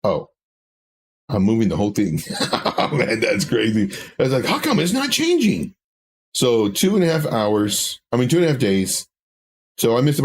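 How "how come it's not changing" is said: high-pitched puzzled self-quote; mock bafflement. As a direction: squeal the question in mock confusion. Mocks own earlier puzzled reaction.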